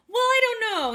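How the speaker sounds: high, loud mock whine; reluctant. Patient dodging the doctor's tetanus shot, reluctant.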